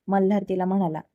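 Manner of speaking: ordinary delivery, nothing marked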